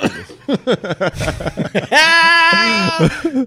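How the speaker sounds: high, loud burst of laughter; loud cackling laugh. Real, not acted. Host cracks up after joking about moms, loud laughter. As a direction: crack up loudly, high and long.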